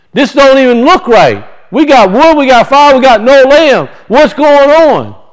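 put-on a puzzled boy pressing his dad about the missing lamb; a son demanding to know where the lamb is; young Isaac pleading. Preacher voices Isaac asking his father.

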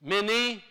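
"mini" ending in an ee sound; pronunciation demo. Shown as a demonstration. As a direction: say "mini" as min-NEE. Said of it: "three ways this thing".

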